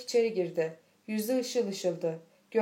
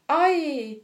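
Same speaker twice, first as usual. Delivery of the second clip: short, high cry of pain; a brief raised "ay" from the next room; small scream; 5 semitones higher, 6 dB louder than usual. A narrator's reading. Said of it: "küçük bir çığlık işitildi". (küçük bir çığlık)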